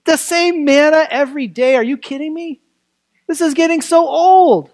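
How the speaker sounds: high-pitched exasperated mock whine; mock complaining. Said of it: "They grumbled".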